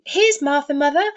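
put-on high, loud children's cry; excited children's cry. A narrator's reading. Young Cratchits shouting to their mother, thrilled. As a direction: cry it out like delighted little kids. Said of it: "cried the two young Cratchits".

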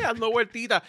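ordinary delivery

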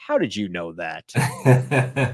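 low, slow imitation of a baffled man; deep, slowed-down retold question; startled man, imitated. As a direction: drop low and draw the question out. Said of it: "He looked around immediately and he says".

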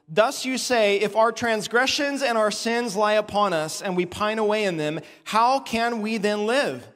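preacher reading Ezekiel aloud with force; scripture reading. Acted. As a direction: proclaim God's word.

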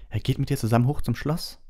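high-pitched, affronted question; indignant. A narrator's reading. Hermine sees Hagrid leave without them, indignant. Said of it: "sagte Hermine entrüstet".

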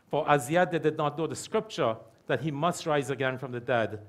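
ordinary talk

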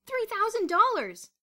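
high, loud, drawn-out cry; excited exclamation. A narrator's reading. Bruno announcing the prize to Boots, excited shout. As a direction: shout the sum in amazement. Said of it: "Bruno exclaimed".

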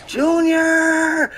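high, drawn-out greeting call; excited call. Real, not acted. Streamer hailing a viewer by name, excited call.

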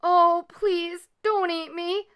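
high, pleading outcry; scared youngster's high plea; frightened cry. A narrator's reading. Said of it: "Leola cried".

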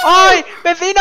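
high, loud yell for the neighbor; frantic high-pitched shout; panicked call. Pretend. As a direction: cry out in alarm. Calls neighbor amid creeper attack.

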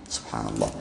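a low, soft breath of praise under the voice; murmured subhanallah. Real, not acted. Preacher to himself between points, softly murmured. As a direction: sigh it to yourself.

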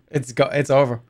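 ordinary delivery

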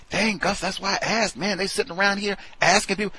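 high, fast mocking coworker voice. Mocking gossiping coworkers, high fast voice.

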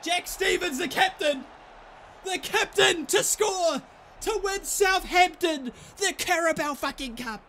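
dramatic commentary. Real, not acted. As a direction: build up the deciding kick like a commentator. Builds up the deciding kick.

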